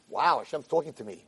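soft aside in wonder; quiet amazement. Acted. Yankel the farmer hears Hashem, quiet amazement. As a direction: drop to a small, awed voice.

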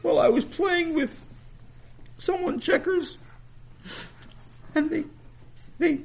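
put-on high, upset little boy; a kid confessing, pitched high; young boy voice. Sammy voiced by narrator.

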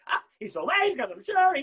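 high, loud, gleeful exclamation; mock happy. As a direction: exclaim it as a delighted mother would. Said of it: "She should be laughing and happy".

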